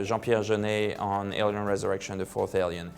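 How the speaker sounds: ordinary talk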